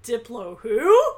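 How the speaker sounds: loud, slow, incredulous squawk; baffled question. A narrator's reading. Bess hearing of diplodocuses, loud baffled outburst. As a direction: blurt it out in mock bafflement.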